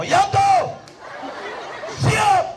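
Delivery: high-pitched drawn-out commander's call; komandan memanggil. Acted. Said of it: "Panggil dari Jawa". (panggilan komandan, melengking)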